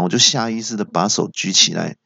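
ordinary delivery

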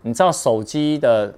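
usual speaking voice

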